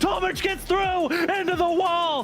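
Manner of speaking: rising shouted race call; excited commentary. Real, not acted. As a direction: call the finish at full excitement. Live race finish call.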